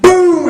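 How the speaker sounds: high, drawn-out boom; imitated punch impact. His right hand catches brother, booming impact.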